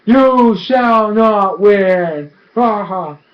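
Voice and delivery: very slow, booming mock-heroic cry; defiant hero. Korra popping out to defy the villain, hammed-up. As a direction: stretch every word in hammed-up defiance. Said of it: "and she's like".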